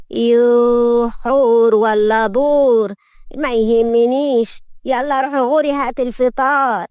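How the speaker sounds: slow, drawn-out grumpy matriarch; dismissive old grandmother. Acted. Grandmother dismissing the maid's name, crabby. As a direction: drag the words out in crabby dismissal.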